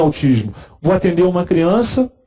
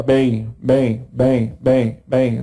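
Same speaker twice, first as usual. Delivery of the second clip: echolalia imitation; 6 semitones lower, much slower than usual. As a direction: repeat the word like a mechanical echo. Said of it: "Dá um eco pra você". (imitando ecolalia, repetição monótona)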